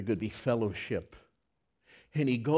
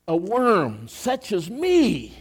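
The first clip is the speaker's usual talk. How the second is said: slow, higher, stretched words; awed preaching; 8 semitones higher, 6 dB louder, slower than usual. Acted. Pastor marvelling to the congregation that God wanted him, slow and awed.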